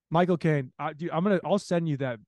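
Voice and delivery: normal talking voice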